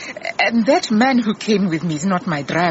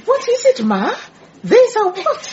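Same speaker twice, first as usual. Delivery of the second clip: urgent, much higher questions; alarmed; 14 semitones higher than usual. Acted. Boss pressing her frightened secretary, high urgent alarm. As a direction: demand an answer in rising panic.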